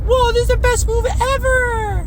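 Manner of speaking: very high, hammed-up childish excitement; awestruck kid. Player mimicking childhood self wowed by a move, high excited squeal. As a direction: squeal it like an amazed little kid. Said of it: "and I'd be like".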